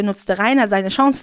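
normal talking voice

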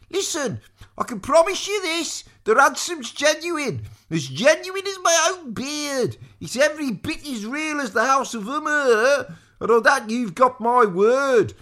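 high, loud, insistent squire voice; earnest squire. A narrator's reading. Squire swearing the ransom is genuine, high and insistent. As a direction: vouch for it loudly in a high voice.

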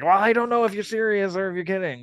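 high, quick imitation of unsure friends; mock flustered. Imitating ex-wife's friends who can't read deadpan humor, mock flustered. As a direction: rush it out high, lightly sending up their worry. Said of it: "they would get scared".

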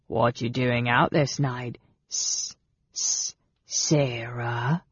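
sneering goblin drawing out the hissed S; mocking hiss. A narrator's reading. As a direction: taunt her, hissing into the name. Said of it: "He hissed the name mockingly".

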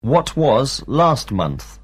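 slow, careful drill question; slow teacher question. Shown as a demonstration. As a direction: pace it slowly so pupils follow. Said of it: "teacher drilling questions".

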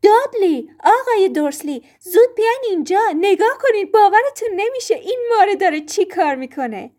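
high, loud panicked calling across the room; frantic high cry for help; terrified shout. A narrator's reading. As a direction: yell for them in alarm, pitch way up.

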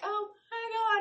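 high squealed oh my God; excited greeting. Retold or imitated. People spotting her in town, high excited squeal. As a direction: squeal like seeing an old friend.

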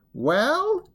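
very high, questioning cry; impatient. A narrator's reading. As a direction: demand an answer impatiently. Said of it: "cried the tiger impatiently".

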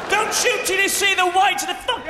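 high, loud army shout; barked order. Retold or imitated. Officer ordering his troops to hold fire, yelling high. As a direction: bellow it down the line.

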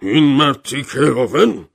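loud, high, drawn-out indignation; outraged. A narrator's reading. General denounces the unknown host, outraged. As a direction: fume slowly and loudly, pitch swooping up.

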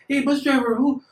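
high-voiced imitation of a native accent; native Mexican accent. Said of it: "because that's a certain accent".

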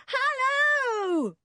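long, loud, high-pitched hello; drawn-out shouted greeting. A narrator's reading. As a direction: holler a greeting from afar. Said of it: "shouted George".

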